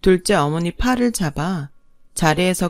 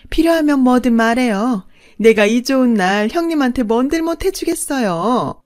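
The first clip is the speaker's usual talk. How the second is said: gloating sweetness; 5 semitones higher, 3 dB louder than usual. Acted. Second wife gloating to the first wife, sickly sweet. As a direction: rub her victory in sweetly.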